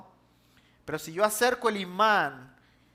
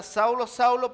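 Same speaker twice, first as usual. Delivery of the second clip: calling out; 6 semitones higher, 4 dB louder than usual. Acted. Jesus calls out to Saul.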